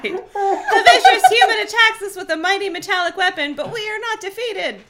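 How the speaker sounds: high, laughing pompous alien voice; tiny alien cry. A narrator's reading. The Helmicron taunting the human, high gleeful voice. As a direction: play a boastful little alien. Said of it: "one of the Helmicrons cried".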